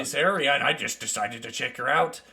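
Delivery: lightly voiced mountain dwarf; easygoing dwarf traveler.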